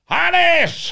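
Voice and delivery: woman guard yelling his name from a distance; calling out. Retold or imitated. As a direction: call his name from far away. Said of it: "hat sie immer gerufen".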